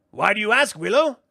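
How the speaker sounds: high, loud, probing sprite voice; suspicious brownie. Acted. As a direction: press him for an answer. Rule grows suspicious.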